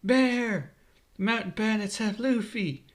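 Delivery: acted shout of alarm; urgent yell. A narrator's reading. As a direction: call out for help, pitch way up. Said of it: "Makino yells as she runs".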